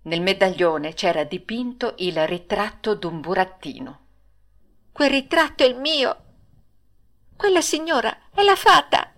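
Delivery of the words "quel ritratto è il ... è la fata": rising higher with delighted recognition; joyful inner exclamation. Acted. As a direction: gasp it out in happy surprise. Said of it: "disse dentro di sé Pinocchio".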